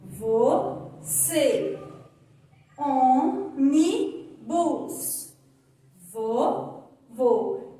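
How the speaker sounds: você, ônibus, vou with a tight closed ê and ô; teacher modelling closed vowels; closed vowel demo. Shown as a demonstration. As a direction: close the vowels, stress them clearly. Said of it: "Repitam comigo".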